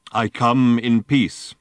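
very slow, deliberate robot. A narrator's reading. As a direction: announce it like a giant machine. Giant robot speaks.

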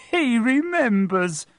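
high, slow, delighted exclamation; overjoyed to be remembered; excited cry. Acted. Diggle crying out to the crowd, high and delighted. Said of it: "cried Daedalus Diggle".

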